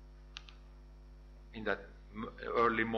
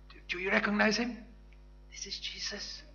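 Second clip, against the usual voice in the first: fast, higher, eager recognition; excited John; 7 semitones higher than usual. Acted. John tells Peter on the boat, fast and excited. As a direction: blurt it out in wonder.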